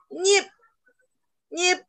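NYIP short and high; short high stopped "Nyip"; fourth tone. Shown as a demonstration. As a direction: cut "Nyip" off quickly on a high pitch. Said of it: "Nada empat seperti ini".

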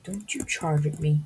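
normal talking voice

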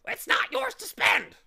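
It's not "yours" to spend, "yours" hit in a high loud shriek. Acted. Bird twisting the boy's words, "yours" shrieked high. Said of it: "the bird shrieked again".

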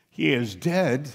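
ordinary talk, nothing marked

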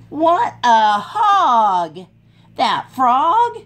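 lively storybook voice for little ones; animated read-aloud for kids; reading to children. A narrator's reading. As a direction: read it like a picture book at story time. Reads picture book aloud.

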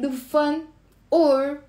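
ordinary talk, nothing marked

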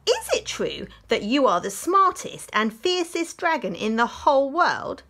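high, loud flattering princess; butter up the dragon, high and bright-eyed. Acted.

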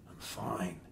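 faint, barely audible reply; weak voice. Acted. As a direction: say it weakly, barely there. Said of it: "he answered weakly".

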